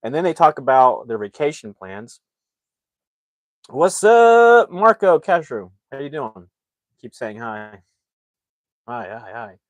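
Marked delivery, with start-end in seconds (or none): 3.7-6.5 loud, high, excited hello; shouted greeting. Real, not acted. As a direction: hail an arriving viewer with big energy. Greeting a viewer on livestream.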